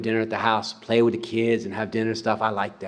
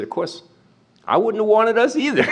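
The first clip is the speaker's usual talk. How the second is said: amused, higher and lighter; smiling joke; 8 semitones higher than usual. Retold or imitated. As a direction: tease it with a grin, voice lifted. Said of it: "then he smiled and he said".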